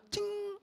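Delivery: vanishing ping sound effect; a very high, sustained ting; high ringing "cing". Shown as a demonstration. Imitates thoughts vanishing. (bunyi "cing" melengking tinggi)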